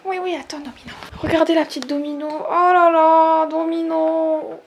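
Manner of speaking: cooing. Real, not acted. Cooing to the cat.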